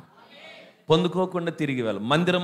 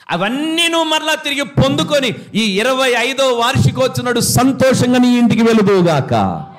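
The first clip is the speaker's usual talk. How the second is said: loud, raised preaching at full force; 8 semitones higher, 7 dB louder than usual. Acted. Pastor blessing the church at its anniversary, preaching loudly. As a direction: proclaim it over the whole church.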